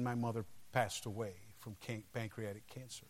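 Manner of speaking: low, hushed, sober telling; real solemn grief. Real, not acted. Preacher recalling his mother's death, quiet and solemn. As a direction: let the voice sink as the loss is told.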